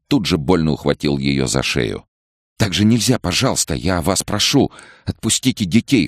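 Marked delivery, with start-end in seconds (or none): 2.6-6.1 high, urgent plea; anxious woman begging for mercy; desperate pleading. A narrator's reading. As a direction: beg them, pitched well up. Woman begs attackers to release children.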